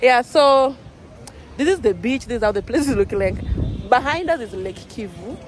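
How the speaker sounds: usual speaking voice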